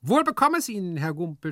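high-pitched solemn pronouncement; solemn toast. Acted. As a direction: offer a ceremonious toast. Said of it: "sprach Hyazinth mit feierlichem Ton".

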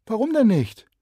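put-on high, loud girl's voice; a bright neighbour girl asking; lively girl. Neighbour girl asks shy boy why not, lively.

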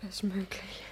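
soft night whisper; hushed whisper as husband comes. Acted. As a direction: whisper in the dark. Alone at night, husband coming.

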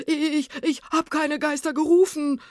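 high, loud panicked stammer; terrified, stuttering yell; frightened shouting. A narrator's reading. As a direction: protest in screaming panic. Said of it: "schrie das Gespenst".

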